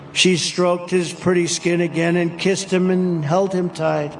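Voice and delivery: usual speaking voice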